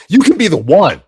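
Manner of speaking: high, loud announcer hype; hammed-up promo. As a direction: sell it like a trailer voice. Hammed-up pitch to viewers.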